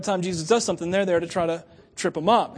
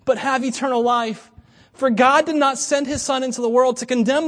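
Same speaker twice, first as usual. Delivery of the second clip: raised, loud solemn reading; scripture reading; 4 semitones higher, 5 dB louder than usual. Acted. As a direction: read it out with weight.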